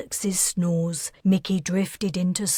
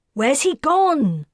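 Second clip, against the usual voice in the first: higher, louder alarmed yell; 8 semitones higher, 6 dB louder than usual. A narrator's reading. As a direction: call out for the missing man. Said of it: "Wilder yelled".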